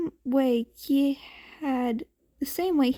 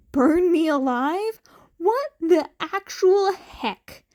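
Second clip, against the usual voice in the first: shocked disbelief; 5 semitones higher, 4 dB louder than usual. Acted. Aizawa's shocked inner outburst.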